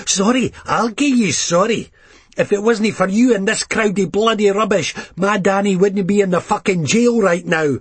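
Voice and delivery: furious grieving mam lashing out; a touch of raging Leith mother; angry mother. Acted. Grieving mother confronts the narrator.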